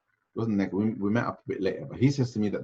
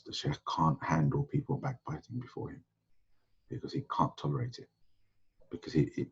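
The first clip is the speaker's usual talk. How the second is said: retold whisper; 8 dB quieter than usual. Senior student explains the sheikh's mood to a classmate, half-whispered. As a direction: quietly confide it as if not to be overheard. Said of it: "he whispers to me and says".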